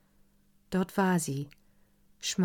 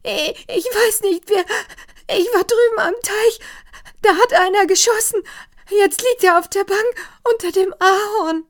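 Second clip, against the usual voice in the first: much higher, louder, fast, shaken report; panicked; 12 dB louder than usual. A narrator's reading. Maid Lina panics while reporting the shot. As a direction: blurt it out in fright.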